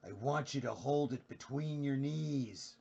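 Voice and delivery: slow, drawn-out Australian Jack Nicholson impression. Streamer doing Jack Nicholson for chat, slow Australian accent. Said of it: "Here's an Australian Jack Nicholson".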